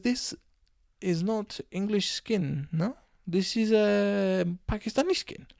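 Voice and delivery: high, slow mock Indonesian driver's accent; uncertain foreign accent. Taxi driver disputing passenger's English origins, slow mock foreign accent. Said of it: "don't know what accent that was".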